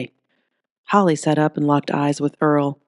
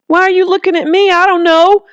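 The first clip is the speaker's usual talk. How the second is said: much higher, louder cornered shout; defensive outburst; 8 dB louder than usual. Acted. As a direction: burst out, overwhelmed and cornered. Earl cornered, snaps back.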